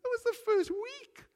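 high, bright dismissal of the bad week; dismissive couple. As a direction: wave away the bad week cheerfully. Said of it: "and they would go".

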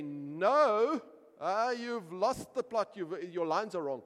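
loud, high director cutting in; director's objection. Retold or imitated. The director interrupting the weeping actor, loud and high. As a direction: shout him down as the angry director. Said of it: "is to say".

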